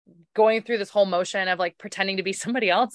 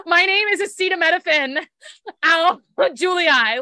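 laughing mock intro; 6 semitones higher, 4 dB louder than usual. Mocks name, laughing through it.

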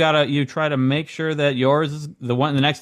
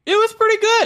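mock-gushing pretty good; 4 dB louder than usual. Mocking the pretty good bit.

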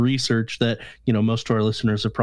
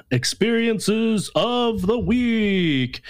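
higher, slow segment announcement; announcer voice; 9 semitones higher, much slower than usual. Acted. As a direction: announce it like a show host.

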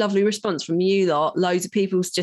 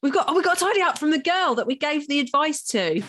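excited; 7 semitones higher than usual. Real, not acted. Excited announcement of email.

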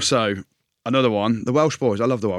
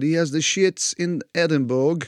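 put-on slowed, higher broken-English French accent; mock French accent; 6 semitones higher, slower than usual. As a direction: say it like the French coach would. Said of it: "why we speak like that".

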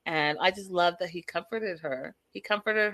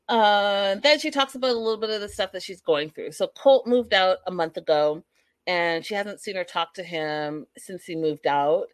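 normal talking voice

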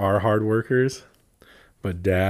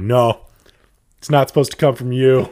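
loud, higher-pitched mock protest; mock objection; 5 semitones higher, 9 dB louder than usual. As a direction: object loudly as the offended listener. Acted objection from Spanish speakers.

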